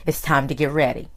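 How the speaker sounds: usual speaking voice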